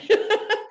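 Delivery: quick real laugh, high and loud; laughing at herself; high short laugh. Real, not acted. Laughs about therapist's advice.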